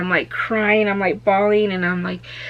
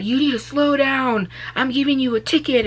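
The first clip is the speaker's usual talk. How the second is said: imitated cop, higher and firm; stern officer; 6 semitones higher, 4 dB louder than usual. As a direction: lay down the law like a cop. Officer giving ticket.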